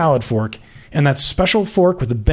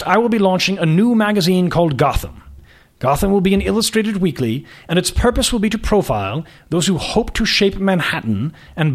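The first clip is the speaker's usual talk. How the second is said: put-on upper-class prep school and British accent; lofty aristocrat sizing up a young woman; aristocratic accent; 2 semitones higher than usual. Magazine boss greeting a job candidate, aristocratic accent. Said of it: "His accent was patently aristocratic".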